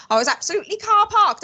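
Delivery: high, loud Welsh exclamation; Welsh slang quote. Acted. Giving an example of Welsh drinking slang, high Welsh exclamation. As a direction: belt it out high in a Welsh accent. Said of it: "you just go".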